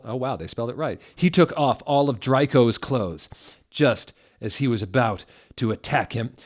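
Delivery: ordinary talk, nothing marked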